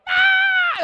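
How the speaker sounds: piercing, very loud and high scream; lone scream on a ride. Retold or imitated. Youth pastor on a ride by himself, piercing scream. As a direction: scream like you're alone on a water slide. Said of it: "like, screaming".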